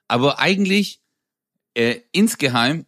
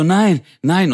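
higher, louder cry of alarm; mock panic; 7 semitones higher, 5 dB louder than usual. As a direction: protest in acted alarm. Acted captive as chair tips.

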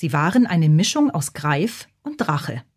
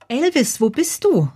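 mildly sleepy, higher-pitched question; a touch of drowsiness in a raised voice; 7 semitones higher than usual. A narrator's reading. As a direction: ask it as if just woken. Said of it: "fragte sie schlaftrunken".

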